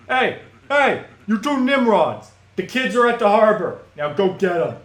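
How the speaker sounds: hammed-up gangster bossing his crew; shouting orders. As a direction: call the henchmen out across the room. Barking orders at henchmen.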